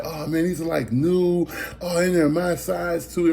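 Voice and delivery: high, eager mock lady voice, slowing down; excited lady. Lady finding the new Reeboks in his bag, high eager mock voice. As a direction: gush over the shoes in a high put-on voice.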